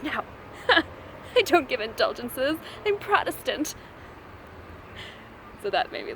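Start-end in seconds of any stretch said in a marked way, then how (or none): 0.0-3.8 slow, laughing through the punchline; quoting the editor while laughing. Retold or imitated. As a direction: giggle out the joke.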